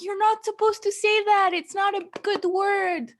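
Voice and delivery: high, mock-scolding neighbor. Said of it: "she was like".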